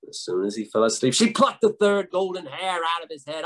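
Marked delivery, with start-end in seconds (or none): none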